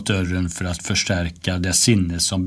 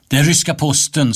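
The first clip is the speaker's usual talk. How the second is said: higher, louder, quick official call; authoritative announcement; 6 semitones higher, 5 dB louder, faster than usual. A narrator's reading. Child announcing the Russian post through a door, authoritatively. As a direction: announce it with your most commanding voice. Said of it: "sa jag med min myndigaste stämma".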